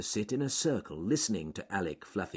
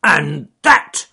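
loud, higher punchy shout; 8 semitones higher, 15 dB louder than usual. Acted. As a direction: yell as you land a blow. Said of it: "Charles Growly Bear shouted".

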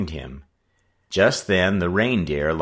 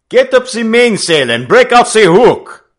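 high, loud sailor's shout; 14 dB louder than usual. A narrator's reading. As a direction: bellow the order across the deck. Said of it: "the Frenchman shouted".

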